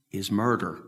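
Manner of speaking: ordinary talk, nothing marked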